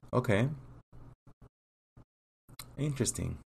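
calm, businesslike interviewer running through the questions, pausing for answers; immigration officer. Acted.

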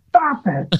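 high, falling mock shout without real volume; mock yell. Psychiatrist cuts off his bewildered patient, high mock yell. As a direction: pitch jumps up for an imitated yell. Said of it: "he yells at the top".

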